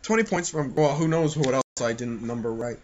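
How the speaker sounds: normal talking voice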